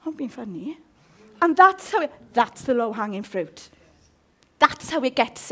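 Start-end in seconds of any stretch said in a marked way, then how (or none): 0.0-0.8 softer petty grumble; mild mock grumble; resentful muttering. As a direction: sulk over a slight. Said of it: "you're like that".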